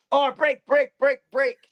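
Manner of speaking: high, loud imitated cry for a break; retold plea to stop. Bully getting blitzed calls time, high urgent mock shout. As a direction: shout it repeatedly to halt the fight.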